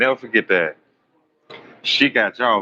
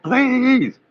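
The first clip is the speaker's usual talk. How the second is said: high, pleading please; mock begging friend; 13 semitones higher than usual. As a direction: beg in a squeaky put-on voice. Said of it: "She like".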